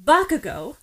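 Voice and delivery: ordinary delivery, nothing marked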